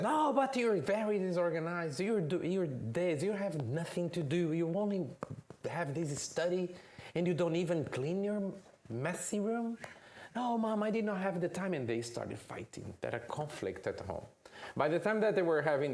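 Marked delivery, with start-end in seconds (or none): 0.0-9.8 higher, nagging mother voice; scolding mom. Acted. Mom tells Junior he is disorganized, high nagging tone. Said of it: "then she said". 10.4-11.7 put-on high, fast protesting kid. Junior protests to mom he had no time, high and fast.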